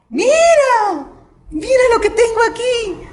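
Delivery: imitating a sister showing off her gift; excited call. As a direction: call out to a friend in delight. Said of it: "le grita a la otra".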